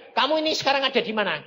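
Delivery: loud, high, rapid demand; insistent question. Retold or imitated. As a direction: press the question loudly and fast. God's question repeated insistently.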